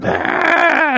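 high mock scream of a street screamer; imitated scream. Retelling a screaming stranger at night, high mock scream. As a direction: yelp it out like the crazy guy. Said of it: "one of those fucking screamers".

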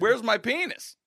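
high-pitched mock yell; high, indignant retold holler. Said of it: "I'm serious, I yelling".

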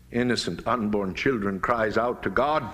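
ordinary talk

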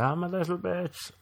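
high, soft pathetic whine; mock self-pity. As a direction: whimper it in defeat. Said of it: "I was like".

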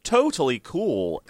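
kids' mock American drawl, slow; a slow, lazy American "Totally cool"; imitating American. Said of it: "started imitating Americans".

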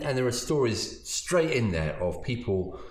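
ordinary delivery

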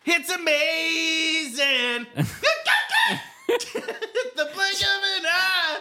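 high, slow raspy rock-star rasp. Acted. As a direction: shout it out like an aging rock frontman. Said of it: "I can do a good [name] impression".

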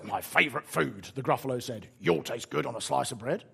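a hint of a hungry beast; lightly voiced Gruffalo. Gruffalo eyeing the mouse as food, lightly acted.

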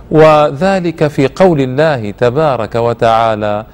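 ordinary talk, nothing marked

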